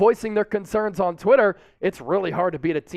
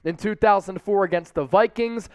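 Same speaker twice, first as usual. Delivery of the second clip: ordinary talk, nothing marked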